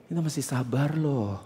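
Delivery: very soft, low, soothing voice; softly coaxing, as a model of gentleness; gentle and patient. Shown as a demonstration. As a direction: demonstrate patience in a hushed tone. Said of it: "kayak gitu".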